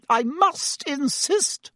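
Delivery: very high, drawn-out hysterical insistence. A narrator's reading. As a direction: insist as if at your wits' end. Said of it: "said Madame Pomfrey hysterically".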